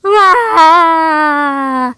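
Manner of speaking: put-on high loud childish wail. Little Sister bursting into tears at her party, wailing loudly. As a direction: bawl it out like a little cub. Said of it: "she was crying so loud".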